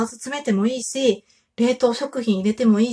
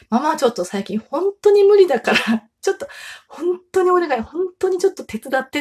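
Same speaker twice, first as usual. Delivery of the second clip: pleading mother; 7 semitones higher, 3 dB louder than usual. Retold or imitated. Mother begging her kids for help.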